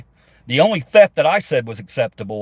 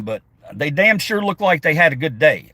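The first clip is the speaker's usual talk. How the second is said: usual speaking voice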